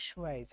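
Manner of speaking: সুয়াইজ with a w-glide and an ai diphthong; teacher models সুয়াইজ, ending in a z sound; correct pronunciation. Shown as a demonstration. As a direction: clear teaching demo of সুয়াইজ. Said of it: "সুইচ হবে না".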